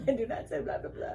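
laughing. Acted. Mocking vampire catchphrase, laughing.

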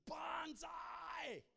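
high, drawn-out battle cry; imitated scream. Retelling buddy jumping off the high board, high imitated scream. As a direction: holler it like a diver leaping off. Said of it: "he'd jump off and he'd scream".

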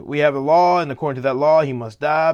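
loud, insistent quoted protest. A narrator's reading. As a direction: press the point loudly, like accusers.

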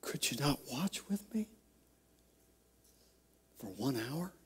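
very soft, halting plea; Jesus's hushed reproach. Acted. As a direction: ask it very softly, pausing midway. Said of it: "Jesus looks at them and says".

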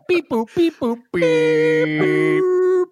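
high beeping modem noise; dial-up modem beeps. Pretend. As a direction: beep like an old dial-up modem. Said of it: "it's about to log into the modem".

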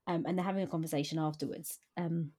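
usual speaking voice

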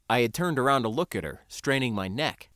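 usual speaking voice

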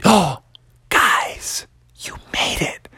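breathless whispered relief; hushed, relieved gasp; scared relief. Acted. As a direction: whisper it to your rescuers. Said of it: "He was so scared".

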